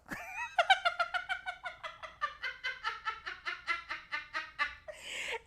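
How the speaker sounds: long, high, soft giggling fit; real laughter. Real, not acted. Storyteller amused at her own offer, real giggling. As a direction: giggle on until breath runs out.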